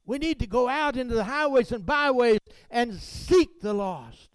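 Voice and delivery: loud, high preaching climb; fiery preaching. Acted. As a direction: preach it out to the congregation. Preaching at full force.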